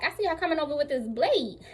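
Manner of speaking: ordinary talk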